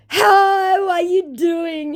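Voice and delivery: very high, slow, drawn-out greeting; silly high-pitched scammer; put-on scammer voice. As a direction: stretch it out in a mock high voice. Voicing the second scammer's greeting.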